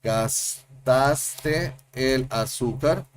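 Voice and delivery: gastaste el azúcar drawn out very slowly; slow, careful teaching read; very slow demo. Shown as a demonstration. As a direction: spell out gastaste el azúcar at crawl pace. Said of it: "we have".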